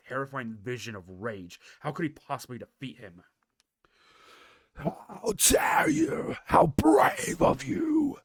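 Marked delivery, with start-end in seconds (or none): 4.7-8.2 slow, much higher, loud sneering shout; scornful dragon. Acted. Deathwing scorns the heroes, loud and sneering. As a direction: spit the praise back as mockery. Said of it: "grief had sharpened to scorn".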